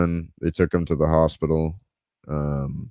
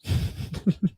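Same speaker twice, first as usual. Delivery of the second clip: breathy laugh; 7 dB quieter than usual. Real, not acted. Laughs at own advice.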